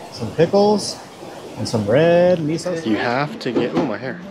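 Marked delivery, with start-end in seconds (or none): none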